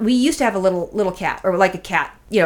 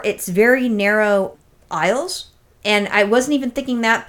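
normal talking voice

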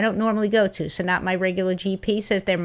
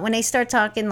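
ordinary delivery

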